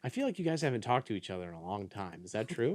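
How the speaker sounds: soft, fast aside; quiet aside. Real, not acted. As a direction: murmur it to yourself. Aside to the characters.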